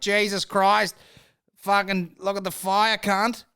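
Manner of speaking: high, loud Australian newsreader parody; hammed-up Australian shout of alarm; mock Australian alarm. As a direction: yell it in broad mock Australian. Acts alarmed Australian news report.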